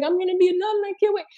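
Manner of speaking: high-pitched, excited mom voice; overjoyed mother. Acted. Mother looking forward to being a nonna, overjoyed. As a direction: gush high as the eager mother. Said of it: "Mother's like".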